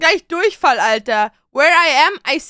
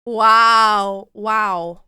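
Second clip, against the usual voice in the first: normal talking voice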